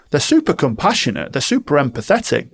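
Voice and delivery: normal talking voice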